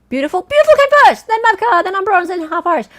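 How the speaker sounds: high, loud, quick burst of results; excited announcement. Real, not acted. Quiz host calling out who came first, excited. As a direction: call out the placings excitedly.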